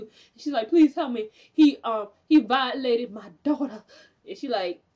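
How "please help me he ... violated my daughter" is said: louder, hammed-up begging; plead, dragging out the last words; retold desperate plea. Imitating the madam begging Queenie for help, mock pleading.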